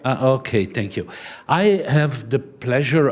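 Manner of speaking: ordinary talk, nothing marked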